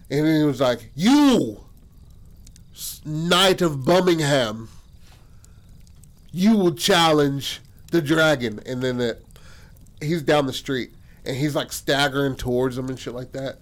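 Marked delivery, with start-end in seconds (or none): 0.9-8.6 slow, raised, hammed-up proclamation; loudly single out the knight like a grand dragon; theatrical dragon challenge.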